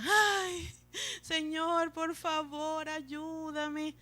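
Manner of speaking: high, drawn-out mock wail to God; self-pitying plea. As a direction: whine it like someone begging for pity. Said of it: "ora con lástima".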